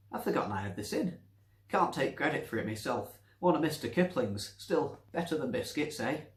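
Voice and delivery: put-on quick, high, cheery old woman; chatty old Nancy. Nancy cheerfully offering cake to the doctor.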